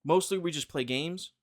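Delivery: ordinary talk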